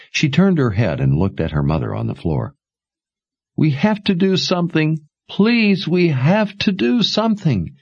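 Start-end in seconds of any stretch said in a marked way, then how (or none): 3.5-7.7 high, tearful begging; high desperate daughter; desperate plea. Acted. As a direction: beg through tears.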